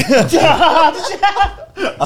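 high laughing burst. Real, not acted. Telling the poker story, real laugh. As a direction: laugh through the words. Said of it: "laughing while telling".

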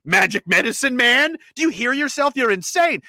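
mock-furious who the hell are you; indignant pushback. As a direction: tell them off in disbelief. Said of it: "they should have said".

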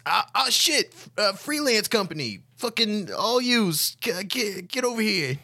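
high, frantic yelling; panicked yell. Acted. Johnny spots the company and yells them over, high panicked yell. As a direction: shout across the street in a panic. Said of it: "he yells".

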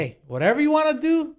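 high-pitched acted brush-off; mock dismissive. Answering the cop's challenge, dismissive. Acts out reply to cop.

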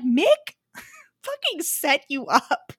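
slowly spelled out, laughing disbelief; slow indignant aside. Retold or imitated. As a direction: drag it out, half laughing. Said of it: "And I'm like".

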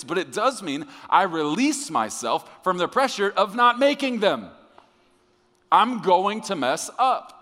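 high, loud, rousing sermon delivery; full-force preaching. Real, not acted. Preacher telling congregation God covers their mistakes, fired-up shouting. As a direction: drive it home at the top of the preaching voice.

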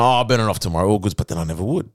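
normal talking voice

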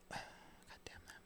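sigh, then whisper it; barely audible, voiceless exasperation; whispered curse. Real, not acted. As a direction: curse under your breath. Exasperated aside after a sigh.

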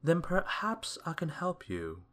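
high-pitched storybook wizard; helpful wizard. A narrator's reading. Wizard offering Mister Mean help, high-voiced and obliging.

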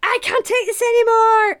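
high, loud hammed-up cry; mock outburst. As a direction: burst out in fake despair. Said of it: "Tim is like".

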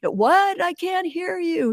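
high, drawn-out mock holler; singsong long-distance shout, played lightly; imitating distant caller. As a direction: call back as if you can't hear across water. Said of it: "He said".

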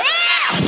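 high, loud yelp of amazement; awed gasp. Acted. Everybody gasps at the tuxedoed eagle. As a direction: gasp like you saw a miracle.

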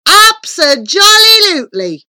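high, loud exuberant cry; delighted agreement. A narrator's reading. Grinning visitor agrees with the wizard, delighted. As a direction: sing out each chunk with glee.

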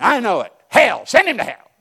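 hammed-up shout, high and loud; mock fury. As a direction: play the wife he wished had raged with him. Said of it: "instead of agreeing with me and going".

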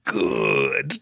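very low, drawn-out villain voice; low evil-ruler impression; Emperor. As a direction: intone it as a deep, sinister overlord. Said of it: "I'm an Emperor guy".

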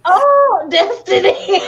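loud, high burst breaking into laughter; real amused shriek; laughing outcry. Real, not acted. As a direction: cry out the name, cracking up.

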